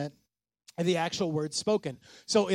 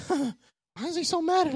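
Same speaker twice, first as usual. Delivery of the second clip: mock listener voice; 11 semitones higher, slower than usual. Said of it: "imagined congregation thought".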